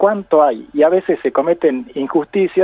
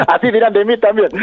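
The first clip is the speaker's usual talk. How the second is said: higher, words bubbling through laughter; laughing; 6 semitones higher than usual. Real, not acted. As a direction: keep joking through the laugh. Sigue la broma riéndose. (hablando a carcajadas)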